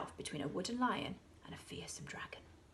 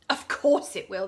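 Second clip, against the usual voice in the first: loud confident answer; children reassuring; 11 dB louder than usual. A narrator's reading. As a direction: dismiss her worry loudly. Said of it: "the others said".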